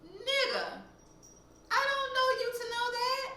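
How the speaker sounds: high-pitched incredulous retort; exasperated disbelief. Real, not acted. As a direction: shoot up high in sheer disbelief.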